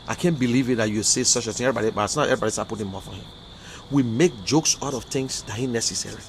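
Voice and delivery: ordinary talk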